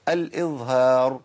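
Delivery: "الإظهار" low and slow, long final vowel; drawn-out term. Shown as a demonstration. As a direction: stretch out "الإظهار" as the key term. Teacher stresses the term's name. (مد كلمة "الإظهار" ببطء وبصوت أخفض)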